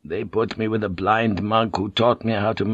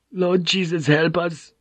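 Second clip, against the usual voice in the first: high, frightened plea; whimpering; 8 semitones higher than usual. Acted. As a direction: whimper a desperate prayer. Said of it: "someone whimpered".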